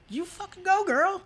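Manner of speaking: put-on high, sassy "you go girl" cheer. Cheering on the grandma biker in a high sassy voice. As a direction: squeal it like a fan girl.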